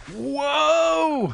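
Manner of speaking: long, high, drawn-out whoa; soaring mock-amazed cry; hyped game-show guy. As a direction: gush it like a hyped TV presenter. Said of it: "what the GamePro T V guy said".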